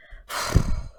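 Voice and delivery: audible puff of breath; weary sigh. Acted. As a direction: sigh after being yelled for. Performed sigh in the monologue.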